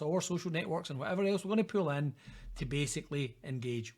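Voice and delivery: normal talking voice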